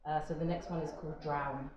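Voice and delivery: usual speaking voice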